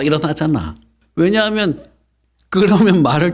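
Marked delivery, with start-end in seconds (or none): none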